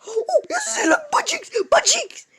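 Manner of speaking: very high squeal through laughter; real giggling shriek; laughing squeal. Real, not acted. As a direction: squeal it out while cracking up. Laughing at character.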